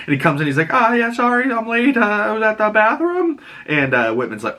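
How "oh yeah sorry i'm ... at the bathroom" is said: high sheepish excuse voice; sheepish mock Peter. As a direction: squeak out a nervous excuse, pitched up. Peter excusing himself to Whitman.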